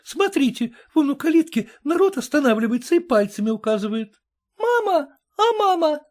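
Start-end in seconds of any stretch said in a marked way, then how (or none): 4.4-6.0 высокий детский голосок; спроси звонко, как малыш; маленький мальчик. A narrator's reading. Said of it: "спросил маленький мальчик". (детский голосок, спрашивает маму)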